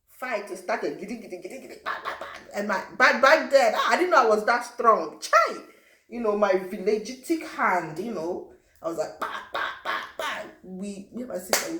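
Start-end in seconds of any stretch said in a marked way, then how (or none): 1.0-2.3 much softer rapid mock punching noises; quick whispered fight noises; fight sound effects. 5.2-5.6 a much higher cry of astonishment; sharp high surprised yelp; amazed exclamation. Real, not acted. Exclaiming at own strength. 9.2-10.4 punching noises. Retold or imitated. Imitating her punches.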